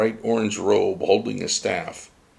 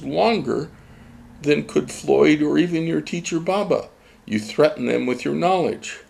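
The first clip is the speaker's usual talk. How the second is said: put-on higher patient child sage; Starfish the teacher; 7 semitones higher than usual. A narrator's reading. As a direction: explain it like a gentle riddle. Starfish explains.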